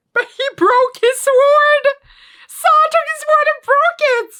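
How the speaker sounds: very high, loud, slowed disbelief; shocked outcry. Real, not acted. Fan seeing the sword break, high shocked shriek. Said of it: "I just gasped out loud".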